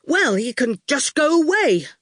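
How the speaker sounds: a hint of a boy trying to be tough; not very fierce. A narrator's reading. Boy tells the goon to leave, weakly fierce. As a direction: try to sound fierce and fall short. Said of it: "didn't come out as fierce as he had hoped".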